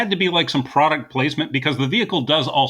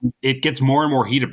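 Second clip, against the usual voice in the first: usual speaking voice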